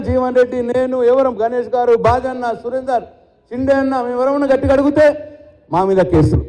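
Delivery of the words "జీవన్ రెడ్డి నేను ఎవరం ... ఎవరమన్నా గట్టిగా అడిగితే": normal talking voice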